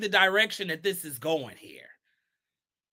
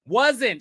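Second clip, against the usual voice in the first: wasn't said loud and falling; all caps stress; 3 dB louder than usual. Shown as a demonstration. Repeating the all-caps word to her viewers. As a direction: hit wasn't like it's in capitals. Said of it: "in all caps".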